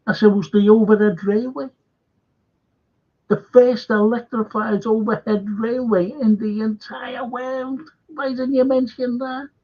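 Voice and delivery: imitating his own heated rant; retold telling-off. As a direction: pile on the grievance, voice climbing. Retelling telling off the Scottish man.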